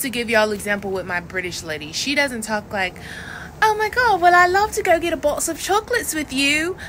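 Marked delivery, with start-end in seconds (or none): 3.6-6.8 high, bubbly and gushing; bubbly valley girl. Shown as a demonstration. Coach showing what her British lady is not: high bubbly gush. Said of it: "That's bubbly".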